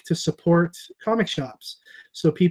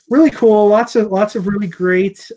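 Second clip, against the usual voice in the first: excited; 5 semitones higher, 6 dB louder than usual. Real, not acted. Enthusing about creators helping.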